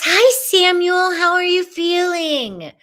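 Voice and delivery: loud, high, gushing hello; excited greeting. Real, not acted. Host spotting a viewer, loud excited greeting. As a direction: break into a high, loud welcome.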